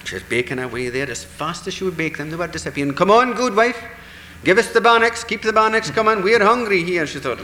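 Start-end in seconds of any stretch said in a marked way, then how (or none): 2.9-7.1 higher, louder, pushy call for more bannocks; a hungry crowd hollering at the good wife; greedy demand. Acted. Fairy folk demand more bannocks.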